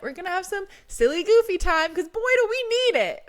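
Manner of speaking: giddy, high-pitched announcement of fun; playful excitement. Real, not acted. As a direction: leap up high with silly glee. Announces silly time with playful glee.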